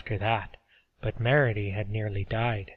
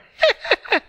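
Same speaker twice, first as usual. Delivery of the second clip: put-on high, loud cackling old woman laugh; breathy coughing laugh; old woman's laugh; 10 dB louder than usual. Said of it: "She laughed again".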